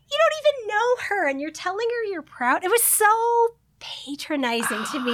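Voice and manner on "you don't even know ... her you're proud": high, quick incredulous jab; mocking retort. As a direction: throw it back at them, disbelieving. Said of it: "I'm just like".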